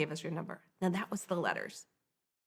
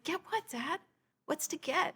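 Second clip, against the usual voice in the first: higher-pitched, softer puzzled questions; baffled questioning; 9 semitones higher, 5 dB quieter than usual. Retold or imitated. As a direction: retell it as a bewildered daughter. Retold puzzlement at her father's remark.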